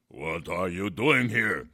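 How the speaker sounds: loud, slow, gruff giant. Acted. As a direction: bellow it like an angry giant. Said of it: "he cried in a very gruff voice".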